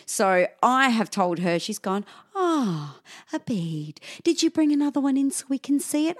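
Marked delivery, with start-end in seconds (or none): 2.4-6.1 lilting, higher-pitched nurse voice; sweetly impractical question, imitated; unfazed nurse. Calm nurse voiced at desk.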